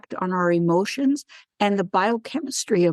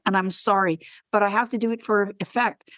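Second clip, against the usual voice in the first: ordinary delivery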